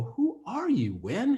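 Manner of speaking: a high, amazed who-are-you to a stranger; stunned question. Retold or imitated. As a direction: pitch jumps way up in disbelief. Stunned asking Gwen who she is.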